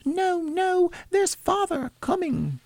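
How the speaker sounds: put-on very high urgent child falsetto; young children crying out. A narrator's reading. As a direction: warn like little children in squeaky high voices. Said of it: "cried the two young Cratchits".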